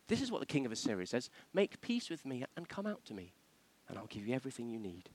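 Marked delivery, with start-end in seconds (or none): 1.5-5.1 coaxing, softly spoken offer; soft appeal. Acted. Envoy appealing to besieged people, softly coaxing. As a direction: coax them gently, well under full voice. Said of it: "It's appeal".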